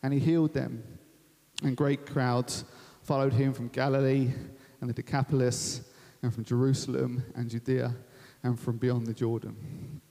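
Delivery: low, measured Bible reading; measured, low-pitched reading with pauses; scripture reading. Real, not acted. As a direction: read it solemnly in short phrases, dropping at the end.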